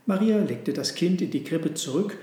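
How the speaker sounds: plain reading voice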